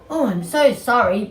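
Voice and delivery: be a sorry sheep. Acted. Sheep apologises to Frankie.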